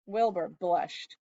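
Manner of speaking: plain reading voice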